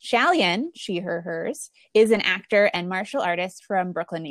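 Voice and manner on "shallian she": ordinary talk, nothing marked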